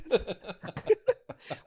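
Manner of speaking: high laugh mid sentence; can't hold the laugh in; real laugh. Real, not acted.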